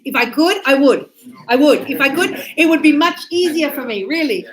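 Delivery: emphatic plea. Real, not acted. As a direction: insist you would if you could. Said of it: "apologetic outburst to class".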